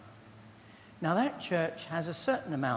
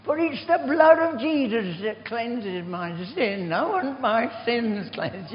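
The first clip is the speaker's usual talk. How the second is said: put-on higher, louder and slow, a pleading old man; imitated loud plea; 8 semitones higher, 7 dB louder, slower than usual. Dying old man begging the clergyman for the wine, loud slow plea. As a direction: beg slowly and loudly for the wine.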